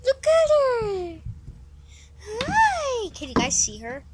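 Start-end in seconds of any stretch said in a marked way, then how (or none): 0.0-3.1 high sing-song voice for a pet; pet talk. Real, not acted. Cooing at dog, high.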